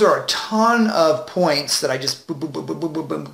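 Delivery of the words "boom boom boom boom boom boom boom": quick, soft string of booms; rapid-fire booms. Shown as a demonstration. Coach recapping the many points thrown out, rapid imitated booms. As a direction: imitate a burst of points being fired off.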